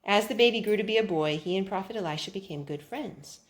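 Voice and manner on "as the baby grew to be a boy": plain reading voice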